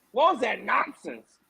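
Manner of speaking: ordinary talk, nothing marked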